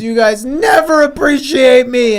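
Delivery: loud, high, hammed-up wounded protest; hurt outburst. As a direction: burst out as an aggrieved monster, mock-upset. Acting out Grimace's tearful outburst.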